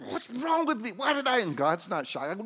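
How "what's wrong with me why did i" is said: very high, loud self-scolding; hammed-up squeal of disbelief; mock dismay. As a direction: wail it at yourself in comic alarm. Acts out shock at himself.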